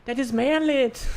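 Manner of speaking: high, slow mock Ukrainian accent. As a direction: put on a bad Ukrainian accent. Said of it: "I don't know how to do a Ukrainian accent".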